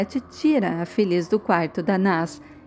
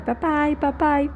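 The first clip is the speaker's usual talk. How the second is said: mild mock yell; 6 semitones higher, slower than usual. A narrator's reading. Naz calling for her father, mildly imitated yell. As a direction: imitate calling out in a raised pitch, lightly. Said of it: "após a Naz começar a gritar".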